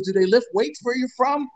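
lightly voiced Lou mocking; Lou impression. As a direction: needle him like a gruff old coach. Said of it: "He goes".

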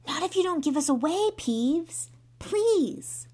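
high, loud, rushed begging; urgent plea. Acted. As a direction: beg him desperately. Pleading with Peeves not to tell.

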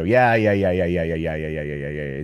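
rattled-off yeahs, fading and dropping in pitch; mock brush-off, trailing downward; dismissive commenter. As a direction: wave it away like an impatient commenter. Mimicking dismissive commenters in quote.

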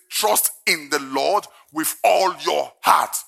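measured, slow reading; solemn verse reading; slow scripture read. Acted. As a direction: state the verse calmly. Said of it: "first reading of verse".

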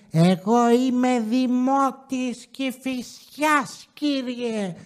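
crazy local woman. Retold or imitated. Crazy local confronts the parking attendant, mock-indignant high voice. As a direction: mimic a crazy complainer, slow and high. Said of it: "έρχεται μία τρελή εκεί πέρα".

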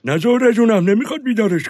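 high, loud stream of refusals; loud high-pitched protest; urgent no. Acted. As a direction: stop her before she goes. Grandpa stops her waking Ali.

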